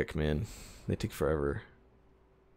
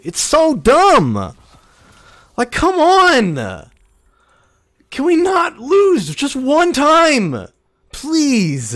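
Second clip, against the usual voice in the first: loud, much higher, drawn-out furious shout; frustrated shout; 13 semitones higher, 8 dB louder, much slower than usual. Real, not acted. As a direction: scream at the game, slowly. Raging at losing.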